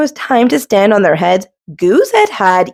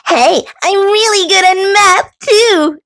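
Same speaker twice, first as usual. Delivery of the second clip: high, bright-eyed boast; exclaiming; 12 semitones higher than usual. Acted. Thistle bragging to Goose, high and eager. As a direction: pipe up eagerly. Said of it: "exclaimed Thistle".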